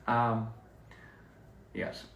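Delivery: ordinary talk, nothing marked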